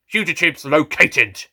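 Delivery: slow, clipped alien shout. A narrator's reading. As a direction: announce it like a robotic guard. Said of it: "the Jadoon captain shouted".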